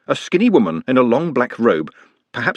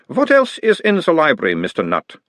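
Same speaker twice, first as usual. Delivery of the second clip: put-on mild Uberwald accent; 7 semitones higher than usual. A narrator's reading. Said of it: "talking like someone from Uberwald".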